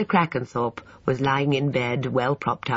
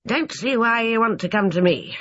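irritable; 9 semitones higher than usual. Acted. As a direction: grumble at the visitor. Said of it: "said Doctor Morris irritably".

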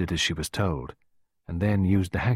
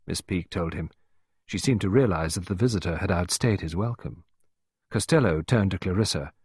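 ordinary delivery, nothing marked

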